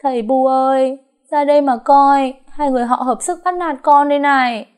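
loud, high wailing for her parents; hammed-up crying for attention. A narrator's reading. Daughter-in-law fake-wailing to parents-in-law, loud. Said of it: "bỗng cô khóc um lên".

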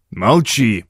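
terse, mildly stern command; barked order. A narrator's reading. Doctor silences old Baines with a curt bark. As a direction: cut him off sternly. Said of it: "рявкнул Доннелли".

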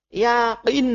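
"Yakin" with k made far back as qaf; Arabic qaf pronunciation. Shown as a demonstration. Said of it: "yakin pakai qaf".

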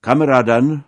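loud salutation to comrades; orator's opening address. A narrator's reading. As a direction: hail the hall to begin a speech. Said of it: "zaczął przedstawiciel czy pseudo-przedstawiciel".

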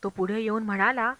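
usual speaking voice

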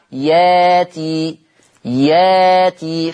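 ياتي said slowly with a long open a in place of the hamza; slow recitation demo of ياتي; hamza replaced by alif. Shown as a demonstration. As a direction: draw out ياتي as a long ya-a-ti, no glottal stop. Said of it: "خلي بالكم من نطقي".

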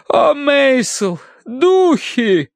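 high, slow, drawn-out lament; swooning wife. Acted. Wife tells husband of the spirits, swooning. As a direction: sigh it out dramatically in a lady's voice.

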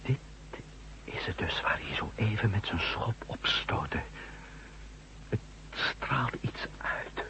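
whispered, awed and slow; hushed awe. Acted. Man whispers in awe beside the strange buried object. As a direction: whisper as if the thing might hear.

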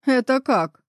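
ordinary delivery, nothing marked

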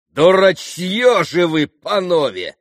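loud, high outburst; blurted insult. A narrator's reading. Mitya suddenly insulting the gentlemen, blurting loudly. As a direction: burst out at them. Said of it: "сорвалось вдруг у Мити".

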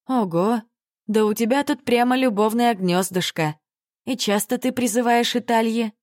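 high, teasing amazement; rising, impressed and teasing; impressed surprise. Acted. Heroine impressed by love nest.

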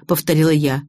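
plain reading voice

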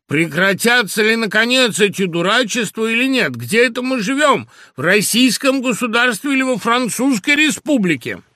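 loud, high, angry scolding; menacing father. A narrator's reading. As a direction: thunder at the household in outrage. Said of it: "грозный голос, голос моего отца". (грозный крик отца)

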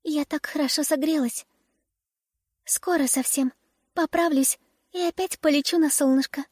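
weak, chirpy little bird voice; cartoon bird. A narrator's reading. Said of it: "чирикнула больная ласточка".

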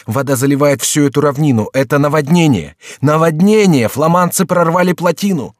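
high, loud alarm; urgent shouted warning; crying out. A narrator's reading. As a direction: raise the alarm at full voice. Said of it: "вскрикнул Генрих".

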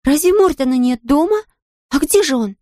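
high-pitched bewildered questioning; high, surprised exclamation; astonished children. A narrator's reading. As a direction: ask as a chorus of startled children. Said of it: "воскликнули все хором".

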